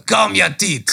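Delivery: high mock-French accent; retry the line in a comic French accent; hammed-up French director's call. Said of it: "French accent".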